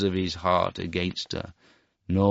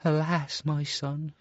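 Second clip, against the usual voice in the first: higher, softer lament; sorrowful cry; 8 semitones higher, 3 dB quieter, slower than usual. Acted. Mother laments her cruel son, sorrowful. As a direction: grieve over your son. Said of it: "she cried".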